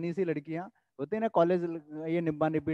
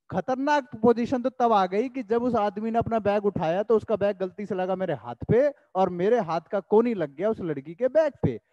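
ordinary talk

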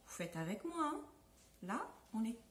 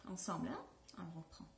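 whisper; 9 semitones lower, 11 dB quieter, faster than usual. Real, not acted. Teacher whispers to class.

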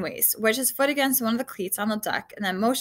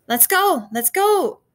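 raised, repeated shout rising in pitch; urgent calling out; 8 semitones higher, 6 dB louder, slower than usual. Acted. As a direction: shout to get them moving. Urging others off the deck.

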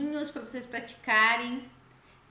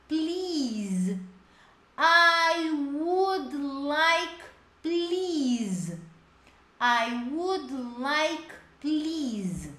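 "I would like, please" drawn out slowly; slow model phrase; 3 semitones higher, much slower than usual. Shown as a demonstration. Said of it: "repeat drills". ("I would like, please" bem devagar)

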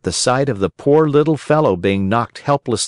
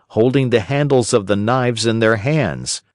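ordinary delivery, nothing marked

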